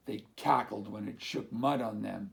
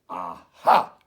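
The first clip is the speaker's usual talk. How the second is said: lower, very loud shout of discovery; triumphant bark; 5 semitones lower, 14 dB louder than usual. Acted. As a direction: bark out the find. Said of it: "he barked".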